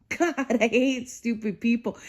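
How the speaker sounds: said through a laugh; laughing repeat. Real, not acted. Repeating her gripe about the brother, now laughing. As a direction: laugh as you say it again.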